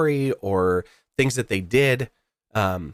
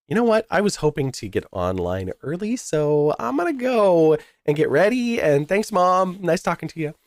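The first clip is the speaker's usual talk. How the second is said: higher-pitched, lilting retold goodbye; breezy sign-off; 7 semitones higher than usual. Ending a phone call with mom to go online, breezy sign-off. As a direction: wrap up a call politely, voice lifted.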